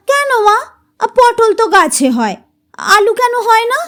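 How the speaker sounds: put-on high childish question; curious child. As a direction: keep pestering in a high child voice. Child keeps asking. (কৌতূহলী শিশুর প্রশ্ন)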